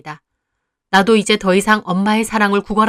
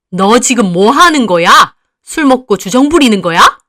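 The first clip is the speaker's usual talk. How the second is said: angry mother; 2 semitones higher, 7 dB louder than usual. Acted. Mother snapping at her drunk daughter, loud and angry. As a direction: snap at her with contempt.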